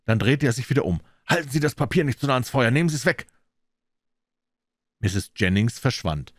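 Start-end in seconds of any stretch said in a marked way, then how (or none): 1.3-3.2 higher, urgent plea; nervous, pressing entreaty; anxious warning. A narrator's reading. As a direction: urge her away from it, pitch raised. Frightened man begs over letter.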